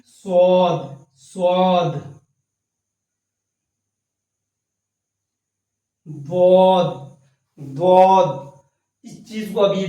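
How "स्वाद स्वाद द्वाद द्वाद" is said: स्वाद drawn out slowly and loud; emphatic swad. Shown as a demonstration. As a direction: stretch स्वाद and द्वाद with force. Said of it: "इन दोनों के बीच की आवाज". (ज़ोर देकर धीरे-धीरे)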